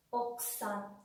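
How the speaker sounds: "okusan" with the U nearly dropped, like "oksan"; okusan con u apagada. Shown as a demonstration. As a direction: say "okusan" in two beats, ok-san. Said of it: "¿Notan la diferencia?". ("okusan" con la u casi muda, como "oksan")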